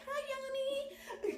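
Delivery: very high, slow squeaky mouse voice; little rat voice. Retold or imitated. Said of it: "She had like a little rat voice".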